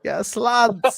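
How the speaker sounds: high, hammed-up Scouse accent. Shown as a demonstration. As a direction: lay on the Liverpool Scouse twang. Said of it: "your Scouse accent's getting better".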